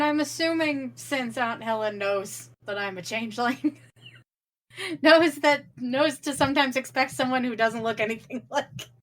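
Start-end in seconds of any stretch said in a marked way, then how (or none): none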